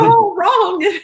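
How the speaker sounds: playfully appalled verdict; high, exaggerated disapproval; mock dismay. Real, not acted. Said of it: "judging the narrator's reading".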